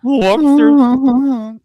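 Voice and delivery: loud, drawn-out mock Peterson lobster; hammed-up impression booming the word Lobster; Jordan Peterson voice. Friend booms lobster in a mock Jordan Peterson voice. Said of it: "Jordan Peterson's accent".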